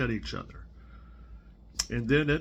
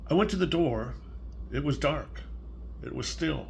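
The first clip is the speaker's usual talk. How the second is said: put-on reading to a child; 4 dB quieter than usual. Grandparent reading Salt Hands to a small child, gentle storybook voice. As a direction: read it softly, like a bedtime story. Reads picture book aloud.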